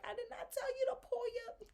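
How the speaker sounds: very high, loud, outraged shriek; retold loud outburst. Yelling at the embarrassed customer, a high outraged shriek. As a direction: yell it at him in outrage. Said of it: "sitting there getting loud".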